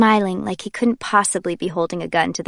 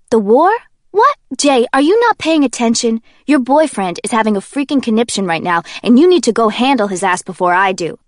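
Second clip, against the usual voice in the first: loud, incredulous scolding; exasperated Kenji; 3 semitones higher, 7 dB louder than usual. Acted. Kenji tells Jay to deal with her boyfriend, loud and exasperated. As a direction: scold her for not paying attention.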